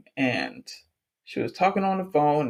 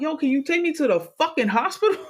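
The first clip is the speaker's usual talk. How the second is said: exasperated demand; 9 semitones higher, faster than usual. Retold or imitated. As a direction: act out an indignant request. Acted demand after broken nose.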